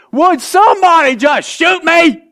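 high, loud, slow fed-up groan of a line; exasperated Jonah. Acted. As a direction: beg to be put out of his misery. Jonah's exasperated modern paraphrase.

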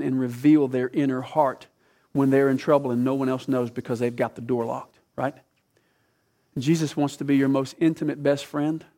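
ordinary talk

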